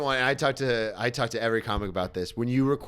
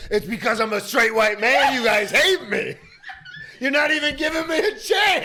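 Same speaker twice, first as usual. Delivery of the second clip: higher, louder self-pitying rant rising to a yell; mock rant building to a shout; 12 semitones higher, 7 dB louder than usual. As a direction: whine at the crowd until it becomes a shout. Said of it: "I'm like".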